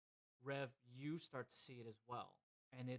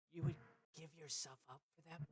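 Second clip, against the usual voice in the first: shadow-cloaked woman; 4 semitones higher than usual. Acted. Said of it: "She goes".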